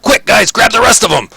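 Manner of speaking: loud, high-pitched call to arms; rally the others in a shout; yelling urgently to allies mid-grapple. Acted. Ront urges cellmates to attack.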